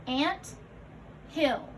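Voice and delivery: "Ant" and "hill" apart, each on its own; a beat of silence between "Ant" and "hill"; split compound. Shown as a demonstration. Teacher modelling compound parts for learners, "Ant", pause, "hill".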